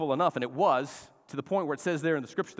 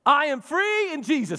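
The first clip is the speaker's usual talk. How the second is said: high, loud declaration; I am free in Jesus, called out loud and high; fired up; 11 semitones higher, 9 dB louder than usual. Shown as a demonstration. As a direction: shout it with joy. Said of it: "that sounds and feels a whole lot better".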